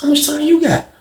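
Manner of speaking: high, lifted question; lightly voiced eager young inmate; high curious ask. Young inmate asks Cliff his sentence, in a high eager voice.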